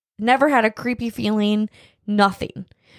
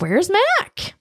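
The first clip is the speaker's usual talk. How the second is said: imitated questioning; 12 semitones higher than usual. Voicing her asking where Mac is.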